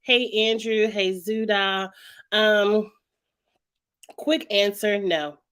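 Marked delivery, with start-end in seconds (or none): none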